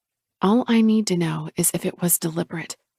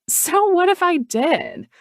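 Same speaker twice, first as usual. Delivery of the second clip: much higher, slow taunt; pitched-up defiant sister; defiant Chloe; 11 semitones higher, slower than usual. Acted. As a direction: throw it back at her.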